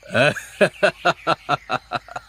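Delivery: a long, easy laugh that falls away; amused laugh. Acted. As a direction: laugh along with the family banter. Father enjoys the cooking contest banter. (পরিবারের খুনসুটিতে মজা পেয়ে লম্বা হাসি)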